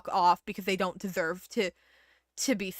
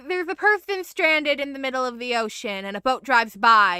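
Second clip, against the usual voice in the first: ordinary delivery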